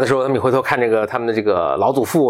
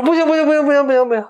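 put-on much higher, childish whining refusal; whiny spoiled girl; 3 dB louder, faster than usual. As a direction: whine no over and over like a spoiled child. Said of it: "各种撒娇卖萌嘛".

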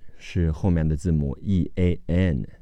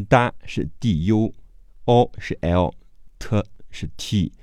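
usual speaking voice

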